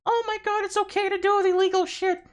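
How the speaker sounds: very high, squeaky mock voice; mocking falsetto. Streamer mocking cult members in a squeaky falsetto. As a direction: sneer at them in a shrill mock squeal.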